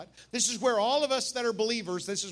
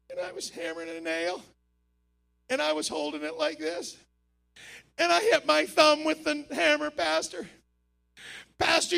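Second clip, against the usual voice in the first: lightly voiced distressed confession; a hint of a panicky man telling his pastor; mildly tearful, pleading; upset new believer; 5 semitones higher than usual. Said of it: "they're crying".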